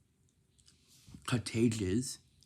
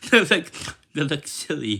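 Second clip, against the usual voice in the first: laughing; 6 semitones higher, 12 dB louder than usual. Real, not acted. Laughing at silly faces.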